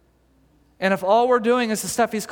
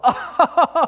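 a short high, loud laugh; God laughing at Moses's worry, high and amused; 8 semitones higher, 6 dB louder than usual. Retold or imitated. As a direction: laugh fondly at silly Moses.

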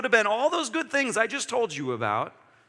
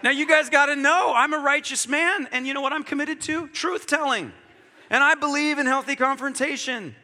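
raised, higher, laughing self-defence; mock self-righteous; 7 semitones higher than usual. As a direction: ham up your own righteousness.

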